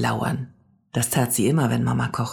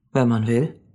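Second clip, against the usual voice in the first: unhurried matter-of-fact reply; calm confirmation. A narrator's reading. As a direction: confirm it simply and steadily. Man calmly confirms again.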